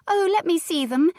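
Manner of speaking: put-on high, loud eager little girl; eager cry. A narrator's reading. Little girl begging to see her brothers, high and eager. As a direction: burst out with a child's delight, high. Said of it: "the little girl cried".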